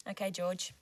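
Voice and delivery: usual speaking voice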